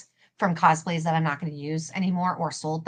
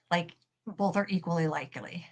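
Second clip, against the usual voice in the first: whispered aside; 7 dB quieter than usual. Real, not acted. Muttered aside about missed message.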